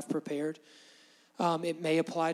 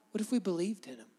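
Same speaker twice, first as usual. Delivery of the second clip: ordinary talk, nothing marked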